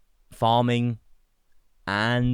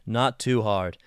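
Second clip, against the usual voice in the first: put-on general American accent; much slower than usual. Shown as a demonstration. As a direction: say it in neutral American. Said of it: "You've got your general American accent".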